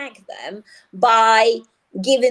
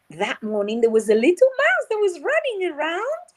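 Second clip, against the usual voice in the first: higher, lively storytelling voice; storytelling to children; 6 semitones higher than usual. Shown as a demonstration. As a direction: spin the tale for little ones. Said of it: "made-up story".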